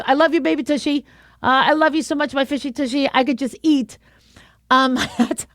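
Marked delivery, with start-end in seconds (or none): 0.0-4.0 playful, adoring parent voice; doting parent. Acted. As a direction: coo at a beloved little kid. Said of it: "rather than to my kids".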